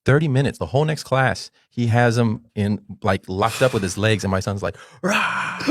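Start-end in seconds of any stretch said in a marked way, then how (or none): 5.0-5.6 high drawn-out roar; mock yell, pitched way up; imitated kid's yell. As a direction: roar like a struggling kid, held back in volume. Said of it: "like, yelling the whole time".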